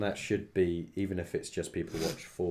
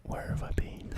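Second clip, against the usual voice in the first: whisper. Pretend. Echoes partner in whisper.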